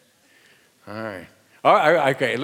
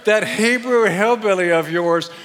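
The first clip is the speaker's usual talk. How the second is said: indignant wife; 7 semitones higher, 7 dB louder than usual. Shown as a demonstration. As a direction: accuse the Hebrew hillbilly with mock outrage. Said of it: "repeat after me".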